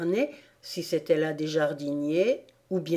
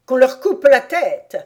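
loud, high death sentence; exclaimed order; 6 semitones higher, 10 dB louder than usual. A narrator's reading. Queen condemns the gardeners, loud exclaimed order. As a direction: cry out the royal order. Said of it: "s'écria-t-elle".

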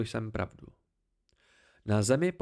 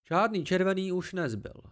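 higher, offhand creature voice; casual; 6 semitones higher than usual. Acted. The Cthaeh answers the boy offhandedly, higher. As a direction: toss it off lightly. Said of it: "Hlas zněl nenuceně".

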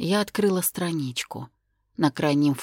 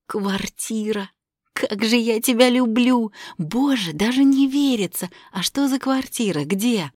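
high, loud joyful gushing; overjoyed; 6 semitones higher, 4 dB louder than usual. Acted. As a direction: burst out with delight. Overjoyed heroine.

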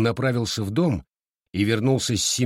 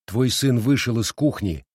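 plain reading voice

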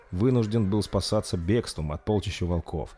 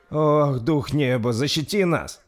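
trembling lips; 7 semitones higher, 4 dB louder, slower than usual. Acted. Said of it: "дрожащими губами произнёс Брун".

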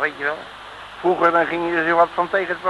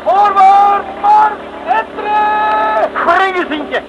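high, loud bellowing war cry; pirate captain yelling at the enemy boat, all-out shout; battle shout; 7 dB louder than usual. Acted. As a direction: roar the boarding order over the sea. Boarding party attack.

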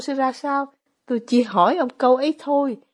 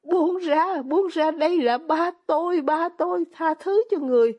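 put-on high, pleading little boy's voice; a small son begging for his father; child's desperate cry; 7 semitones higher than usual. Small son begs the man dragging his father, high pleading cry. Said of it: "Nó gào lên như thế".

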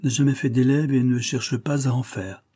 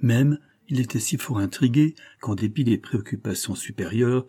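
ordinary delivery, nothing marked

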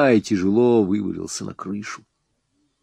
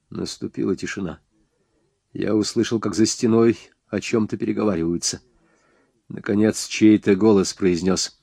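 usual speaking voice

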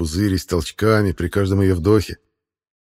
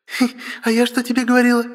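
high fast giggly gloat; giggling; faster than usual. Acted. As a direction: giggle through the words. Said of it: "хихикает сестра".

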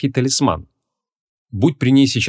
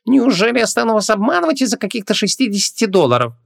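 very high, affronted salesman; indignant; 12 semitones higher than usual. A narrator's reading. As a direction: act deeply wounded at being doubted. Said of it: "возмутился продавец".

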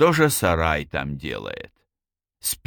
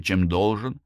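usual speaking voice

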